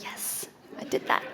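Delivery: hushed, whispery quoted self-congratulation; quiet mock triumph. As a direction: say it almost voiceless, as a private little victory. Acts out delighted reaction to clicking.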